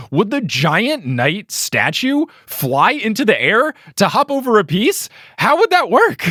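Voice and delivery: building disbelief, jumping up high at the end; incredulous questioning. Real, not acted. Baffled host questioning the story.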